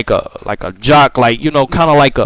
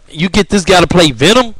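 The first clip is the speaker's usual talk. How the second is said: ordinary talk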